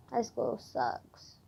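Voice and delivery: very slow and soft; drawn-out grumble. Real, not acted. Student dreading her homework, slow drawn-out grumble. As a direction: stretch each word out wearily.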